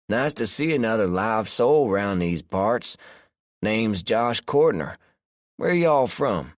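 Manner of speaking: easygoing Southern man greeting strangers; southern accent. A narrator's reading. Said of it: "I smiled at the man's southern accent".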